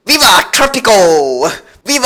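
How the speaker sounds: loud, high imitation of a cheer; mock rallying cry. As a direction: shout it like a revolutionary slogan. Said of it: "And you said".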